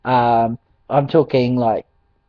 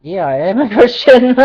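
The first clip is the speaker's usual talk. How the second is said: laughing reply; 10 semitones higher, 8 dB louder than usual. Retold or imitated. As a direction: answer higher and louder, laughing through it. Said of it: "And I said".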